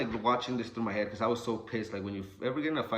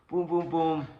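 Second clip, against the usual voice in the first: punch noises; 6 semitones higher, 4 dB louder, slower than usual. Retold or imitated. Imitating punches.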